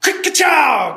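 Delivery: loud, high ka-chow shouted as gunfire; loud vocal shotgun blast. A narrator's reading. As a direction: bark it out like a shotgun going off. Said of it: "Shotgun fire".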